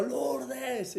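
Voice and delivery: a hint of calling the name out; mild mock yell. Retelling calling his sister at the door, mild mock yell. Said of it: "le pegué el grito".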